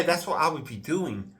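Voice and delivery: usual speaking voice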